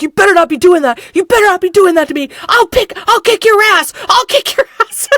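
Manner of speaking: loud, high mock threat; hammed-up teen threatening an actor. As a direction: bluster like a scared kid making threats. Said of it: "starts threatening the guy".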